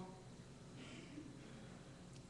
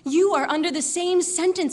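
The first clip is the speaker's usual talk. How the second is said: higher, louder reproach; sharp rebuke; 6 semitones higher, 6 dB louder than usual. Acted. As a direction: rebuke a fellow condemned man forcefully. Criminal rebukes the other criminal.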